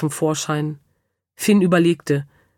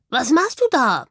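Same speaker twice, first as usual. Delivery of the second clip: higher, croaky baffled question; flabbergasted bird; 5 semitones higher than usual. A narrator's reading. Cockatoo staring at the squeaking hamster, flabbergasted. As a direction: ask it as a dumbfounded cockatoo. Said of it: "starrte ihn entgeistert an".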